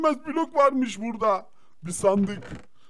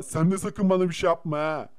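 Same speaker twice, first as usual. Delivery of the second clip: ordinary talk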